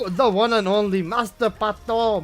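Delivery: loud hype shout; hoarse sleep deprived hype. Real, not acted. Hype over a new gifter.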